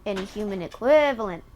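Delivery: ordinary talk, nothing marked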